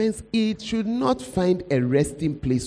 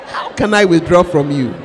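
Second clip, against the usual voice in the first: loud, pleading preaching with cries of anguish; preaching at full force; 7 dB louder than usual. Acted. Preacher voicing a believer who cannot let go, loud and anguished. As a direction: plead it out to the hall like it hurts.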